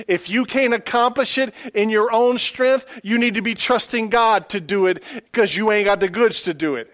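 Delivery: loud, high, fervent preacher; full force preaching. Acted. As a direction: pour it out like a revival sermon.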